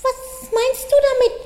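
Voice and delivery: high, puzzled bunny voice; little rabbit voice. A narrator's reading. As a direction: ask it high like a tiny rabbit. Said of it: "wollte der Kleine wissen".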